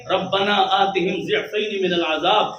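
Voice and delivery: slow chanted Arabic recitation; slow Arabic Quran chant; Quranic recitation. Acted. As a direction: intone the verse slowly.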